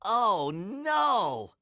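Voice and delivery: loud, high, cartoonish alarm; dismayed cry. A narrator's reading. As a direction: cry out in big storybook dismay. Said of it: "cried Harold".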